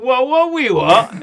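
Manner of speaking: high, loud, drawn-out catchphrase; Borat. Acted. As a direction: exclaim it slowly in a comic foreign voice. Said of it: "something like about Borat".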